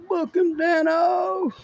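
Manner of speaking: high, slow, drawn-out TV catchphrase; hammed-up catchphrase. Real, not acted. Watching police catch a runner, cheering: high hammed-up catchphrase. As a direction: cheer the cop like a TV fan.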